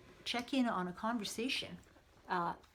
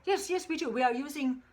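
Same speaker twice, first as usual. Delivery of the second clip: higher, louder hearty doctor calling a colleague; old-time doctor on the phone; 3 semitones higher, 6 dB louder than usual. Acted. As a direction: play a jovial old doctor shouting down a phone line. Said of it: "listen in on a conversation with two doctors".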